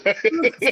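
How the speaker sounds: high-pitched laugh; laughter. Real, not acted. Mocking someone's too-hard laughing.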